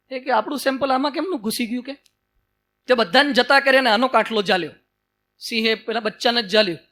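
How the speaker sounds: ordinary talk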